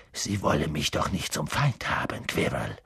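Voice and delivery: low, threatening warning; menacing. A narrator's reading. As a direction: drop the voice and threaten. Snape threatens Quirrell.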